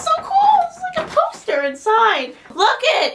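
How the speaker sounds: very high, loud fangirl squeal; thrilled squeal. Real, not acted. Showing viewers the poster inside, thrilled squeal. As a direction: burst out high and loud with delight.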